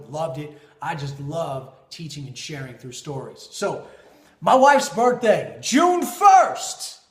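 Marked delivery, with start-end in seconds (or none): 4.4-7.0 much higher and louder, June drawn out; excited buildup. Retold or imitated. Husband setting up his wife's birthday story, excited. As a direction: announce the big day with a grand flourish.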